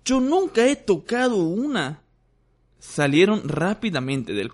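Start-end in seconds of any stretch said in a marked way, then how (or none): 0.0-2.0 put-on high, longing boy's voice; envious whine. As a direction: whine with envy. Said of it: "gimió Ron con envidia".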